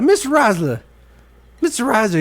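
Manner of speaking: high, loud whiny call of a student; student calling teacher. Retold or imitated. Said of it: "He's like".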